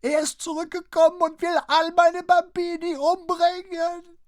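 very high, very loud terrified shriek; old mother shrieks for help in terror. A narrator's reading. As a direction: scream for help in panic. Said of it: "kreischte sie mit erhobener Stimme erneut los".

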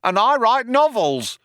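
high-pitched shouted interjection; other cop chiming in. A narrator's reading. Second cop chimes in about his writing, high calling voice. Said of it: "chimed in the other cop".

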